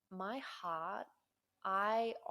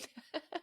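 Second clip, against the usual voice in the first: high, light laughter; amused laugh; 9 semitones higher than usual. Real, not acted. Speaker amused by all those twos, giggling. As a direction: laugh at your own story.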